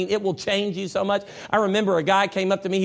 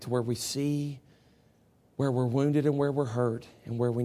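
prayer; 7 semitones lower, 6 dB quieter than usual. Acted. Pastor praying for the church's hearts, soft and low. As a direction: pray gently over the room.

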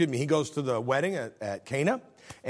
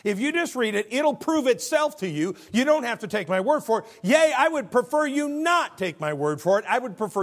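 emphatic preaching; 10 semitones higher, 5 dB louder than usual. Real, not acted. Preacher building to emphatic point.

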